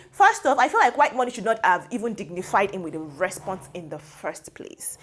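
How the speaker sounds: ordinary talk, nothing marked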